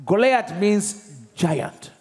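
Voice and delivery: each word drawn out slowly; slow teaching emphasis. Shown as a demonstration. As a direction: spell it out for the class. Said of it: "Means what?".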